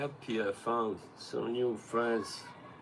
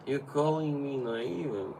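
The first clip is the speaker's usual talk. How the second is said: put-on higher, a light broken English accent like Carlos; 7 semitones higher than usual. Streamer mimics his friend Carlos's broken English accent. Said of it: "I can do his accent pretty well".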